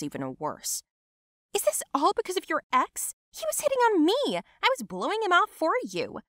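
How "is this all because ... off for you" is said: high-pitched, heated self-defence; outraged, high and insistent; indignant quarrel. Acted. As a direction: fling back a protest, voice pitched way up. Cousins quarrel in public.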